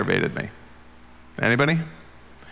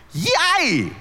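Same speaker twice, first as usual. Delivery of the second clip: squeaky, much higher, ironic little cheer; mock cheer. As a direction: cheer it like it's sarcastic fun. Mock cheer about submission.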